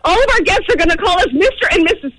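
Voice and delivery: ordinary delivery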